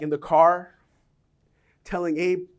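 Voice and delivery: ordinary talk, nothing marked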